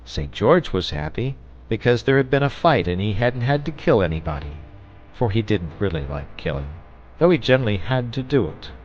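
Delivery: plain reading voice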